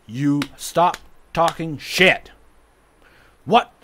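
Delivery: loud, slow, word by word; angry emphasis. Real, not acted. Son telling mom how fights stop, angry emphasis. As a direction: punch each word separately and loudly. Angry answer to own question.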